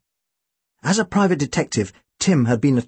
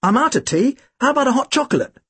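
much higher, eager offer; Tim's voice; 10 semitones higher than usual. Acted. Big brother offers hot chocolate, voice pitched much higher. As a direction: Tim chirps up hopefully, pitched high.

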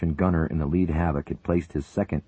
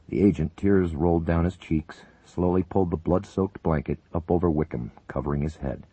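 ordinary delivery, nothing marked

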